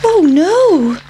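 high, squeaky little pancake voice; a tiny worried pancake cries out; pancake's fretful voice. A narrator's reading. The pancake, alarmed by the farmer's wife, squeaks high. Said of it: "thought the pancake".

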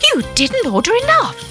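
high-pitched elf blame; accusing. A narrator's reading. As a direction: point the blame squarely at the other elf. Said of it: "pointing his finger accusingly".

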